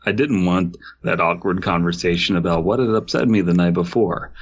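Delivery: usual speaking voice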